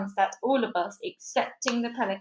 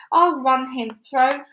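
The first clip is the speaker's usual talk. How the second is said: shouted; 4 semitones higher, 7 dB louder, slower than usual. A narrator's reading. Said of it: "he shouted".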